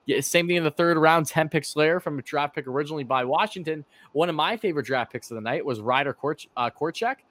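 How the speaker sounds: normal talking voice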